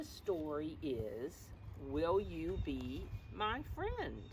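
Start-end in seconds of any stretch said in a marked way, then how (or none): none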